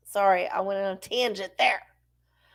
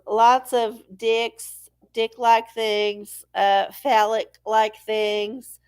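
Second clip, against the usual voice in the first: slow, deliberate list; slow emphasis; slower than usual. Real, not acted. Suggesting phallic bakes slowly for comic effect. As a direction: drag out each item. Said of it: "deliberate slow list".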